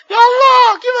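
high sobbing cry of Ya Allah; a real cry bursting out. Real, not acted. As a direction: break down calling on Allah. Weeping over burial in Baqi.

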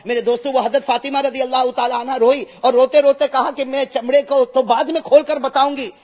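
loud, rising sermon voice; fervent preaching. Acted. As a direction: drive the point home to the crowd. Sermon rising to full force. (جوشیلا بلند وعظ)

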